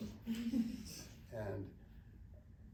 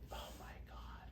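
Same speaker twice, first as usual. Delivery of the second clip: imitated whispered aside, very soft; under the breath, as a dismayed onlooker; mock-horrified whisper; hushed dismay; 10 dB quieter, slower than usual. Boyle groaning in the background.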